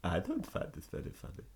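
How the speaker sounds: mock German accent, high; hammed-up annoyed German. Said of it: "It's hard to do a German accent in English".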